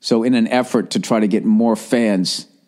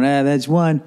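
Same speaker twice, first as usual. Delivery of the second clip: higher, jeering Twitter troll; mock taunting fan; 7 semitones higher than usual. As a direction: needle him like a smug rival fan.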